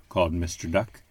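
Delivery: usual speaking voice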